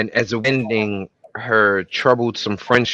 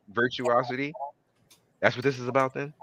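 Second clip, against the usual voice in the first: ordinary talk, nothing marked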